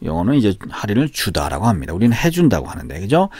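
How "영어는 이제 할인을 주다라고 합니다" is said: usual speaking voice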